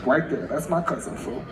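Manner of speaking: low laid-back dude voice. Shown as a demonstration. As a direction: drop it low and cool like a street smooth talker. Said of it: "if I'm doing a low voice".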